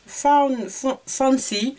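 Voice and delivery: halting English name in a French accent. Real, not acted. Vlogger reading a lipstick shade name, hesitant French accent. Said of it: "mon accent".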